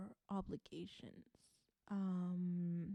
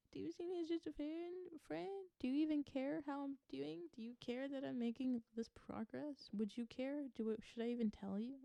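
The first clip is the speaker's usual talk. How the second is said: higher-pitched, insistent questioning; voicing the partner's doubts; 6 semitones higher than usual. Tarot reader voicing the partner's insecurity, higher and questioning. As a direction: rattle off needy questions in a raised voice. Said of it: "could also be them being like".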